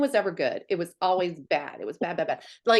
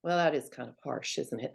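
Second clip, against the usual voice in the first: sheepish husband; 6 semitones lower, 6 dB quieter than usual. Retold or imitated. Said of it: "And he was like".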